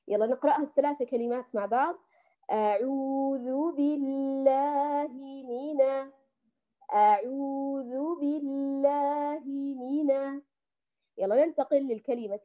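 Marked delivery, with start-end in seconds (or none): 2.5-10.4 slow chanted recitation of أعوذ بالله من; Quran recitation. Shown as a demonstration. Teacher recites the phrase for her students, slow recitation.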